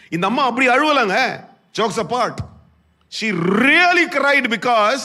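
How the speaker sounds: very high, loud, impassioned sermon delivery; full-force preaching. Real, not acted. Preacher voicing the woman's devotion to Jesus, full-force preaching. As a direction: cry it out to the congregation, stretching key words.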